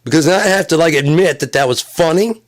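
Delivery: loud, high, mock outrage; high shouting. Comic rants about a slur too funny to hate, high and loud. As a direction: rant like you're offended but impressed.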